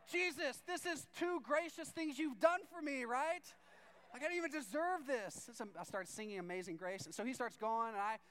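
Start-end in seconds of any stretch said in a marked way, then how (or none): 0.1-5.5 high, loud, gushing thanks; exultant prayer, pitched way up; hammed-up overjoyed gratitude. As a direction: cry out to heaven, overwhelmed.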